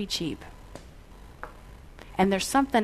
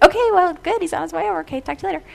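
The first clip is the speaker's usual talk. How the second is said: breezy brush-off; 8 semitones higher, 6 dB louder, much faster than usual. Retold or imitated. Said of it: "And I was like".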